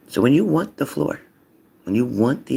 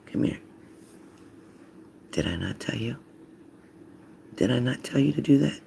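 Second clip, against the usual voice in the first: hushed, quiet scolding; whispering to kids; 7 dB quieter than usual. Acted. Parent getting the kids' attention by whispering. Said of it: "start whispering to 'em".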